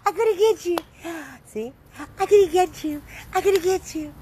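playful high squeal, loud and teasing; high-pitched playful. Real, not acted. Owner playfully chasing her dogs in a high squeal. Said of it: "When I talk all high pitch".

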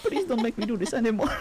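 ordinary delivery

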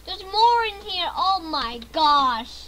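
loud, high cry of dismay; alarmed shout. Real, not acted. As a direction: shout it in shock, stretching the last words. Alarmed by more enemies in game.

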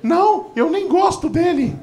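very high-pitched mock girl voice; slow, high imitation of a careless daughter; blithe young sister. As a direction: toss it off in a put-on high voice. Sister's blithe reply, acted.

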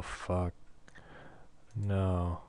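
ordinary talk

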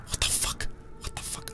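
baffled whispered curse. Real, not acted. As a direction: whisper it in disbelief. Bewildered at vanished window.

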